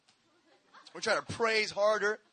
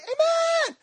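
very high shrill shouted amen; 1 kids' high shout. Retold or imitated. Elementary kids answering the preacher, high shout. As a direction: belt it like a crowd of kids. Five hundred kids responding.

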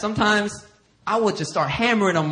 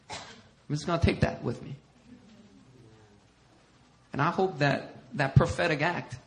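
normal talking voice

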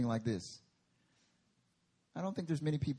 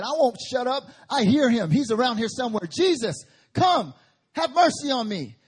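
loud, higher, insistent shouting; defiant yell; 8 semitones higher, 11 dB louder than usual. Acted. Bartimaeus shouting over the crowd to Jesus, loud and defiant. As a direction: refuse to be silenced, cry out. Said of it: "And he said".

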